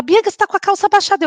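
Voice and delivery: high, fast, startled voice of a receptionist; mock receptionist. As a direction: warn her, quick and high-pitched. Receptionist voiced in retold story.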